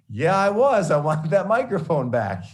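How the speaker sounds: high, loud, laughing confession; laughing admission. Retold or imitated. Student admitting to the professor that he was angry, laughing. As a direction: own up to it with a laugh.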